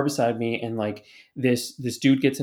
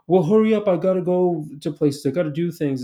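higher, louder mock hurry-up; retold impatient demand, pitched up; 5 semitones higher, 4 dB louder than usual. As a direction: imitate a customer rushing someone along. Acts out impatient waiting customers.

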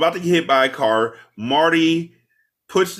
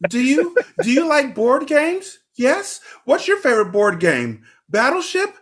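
much higher, giggly flirting voice with laughs; 11 semitones higher than usual.

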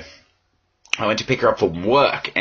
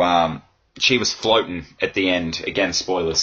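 ordinary delivery

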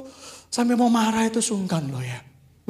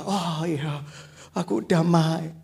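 damai ditiru; 7 semitones lower, 4 dB quieter, slower than usual. Retold or imitated. Menirukan jemaat yang tenang.

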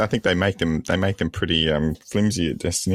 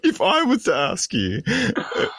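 mocking quote while laughing; 7 semitones higher than usual. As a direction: repeat his words back, laughing and higher. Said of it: "You said".

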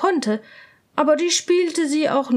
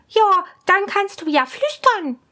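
much higher, louder impatient princess; 7 semitones higher, 4 dB louder than usual. Acted. Princess asks her lady, high and impatient. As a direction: demand the answer, high and curious. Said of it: "sagte die Prinzessin".

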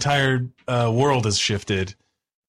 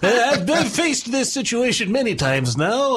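high-pitched know-it-all genius, laughter creeping in; hammed-up brainy nerd voice, pitched way up; Lion; 8 semitones higher than usual. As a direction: play the newly brilliant smarty-pants, high and halting. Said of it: "Lion with his newfound thirty intelligence goes".

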